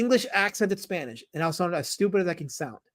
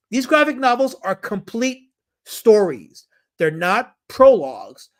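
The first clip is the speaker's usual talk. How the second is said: slow, loud stress on "complete stories" and "not prologues"; emphatic stress; 3 semitones higher, 6 dB louder, slower than usual. Real, not acted. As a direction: hammer home "complete stories", then "not prologues", slowly. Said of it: "I have to stress".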